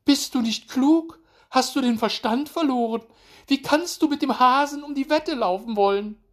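very high, loud scolding; shrieking wife. A narrator's reading. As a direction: berate the husband in falsetto. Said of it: "schrie den Igel seine Frau".

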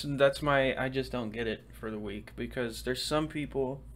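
normal talking voice

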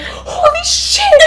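high, slow, laughing "holy shit"; shocked cry. Retold or imitated. Recalling seeing the man's face, laughing shock. Reacting to man on phone.

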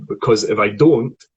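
ordinary delivery

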